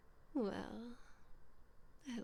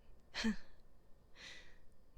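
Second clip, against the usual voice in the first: mocking laugh. Noblewoman laughing at her prisoner's reaction, mocking. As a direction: laugh down at the helpless peasant.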